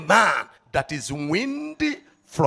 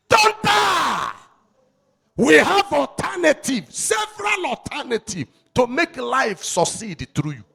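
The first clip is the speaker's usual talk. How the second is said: rising, louder and higher pulpit cry; preaching at full force; 7 semitones higher, 6 dB louder than usual. Acted. Preacher urging the congregation not to give up, loud. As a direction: lift the church with it.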